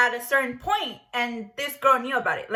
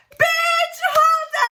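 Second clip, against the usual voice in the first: outraged shout; 12 semitones higher than usual. Real, not acted. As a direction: explode in disbelief at the friend. Outraged reaction to being roofied.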